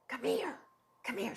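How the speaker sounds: mock hushed summons to the chef; hushed beckon. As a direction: call him over under your breath. Retold beckoning the chef quietly.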